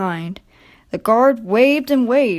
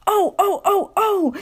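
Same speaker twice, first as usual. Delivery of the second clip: high, startled cries of alarm; screaming trucks; 10 semitones higher than usual. A narrator's reading. Trucks jolted by Edward, crying out in alarm. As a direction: squeal in fright as you're jerked. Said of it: "screamed the trucks".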